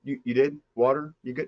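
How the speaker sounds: high, hesitant, friendly Midwesterner; awkwardly polite villain small talk; normal Midwest guy. Acted. Mastermind greeting his tied-up captive, awkward high Midwest-guy voice. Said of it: "just a normal guy from the Midwest".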